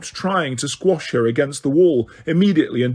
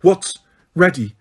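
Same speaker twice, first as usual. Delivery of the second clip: demanding; 3 semitones higher, 3 dB louder than usual. A narrator's reading. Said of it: "demanded Umbridge".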